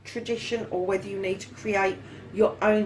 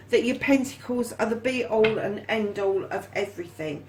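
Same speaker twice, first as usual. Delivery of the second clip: usual speaking voice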